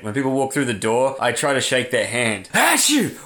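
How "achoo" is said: high, abrupt fake "achoo"; mock sneeze. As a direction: explode into a pretend sneeze. Greeter sneezes, acted.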